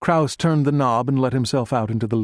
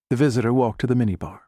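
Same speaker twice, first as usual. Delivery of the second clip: usual speaking voice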